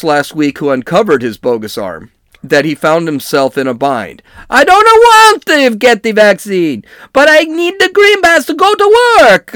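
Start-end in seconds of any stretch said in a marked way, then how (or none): 4.5-9.5 high, loud mock Italian accent. Dentist pleading with the nurse, in a hammed-up Italian accent. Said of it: "with Italian accents".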